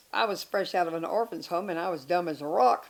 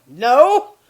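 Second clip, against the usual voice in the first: retold blunt no; 5 semitones higher, 15 dB louder than usual. Retold refusal of marriage proposal.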